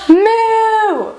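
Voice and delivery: long, high pretend moo; playful high-pitched mooing; cow moo. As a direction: imitate a cow with a drawn-out moo.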